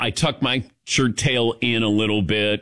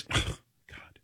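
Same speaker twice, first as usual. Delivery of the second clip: exasperated; 13 dB quieter than usual. Retold or imitated. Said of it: "He goes".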